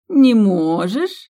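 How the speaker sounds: loud slow sarcastic echo. Acted. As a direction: savour the disbelief, sneering. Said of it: "саркастически переспросила Сьюзи".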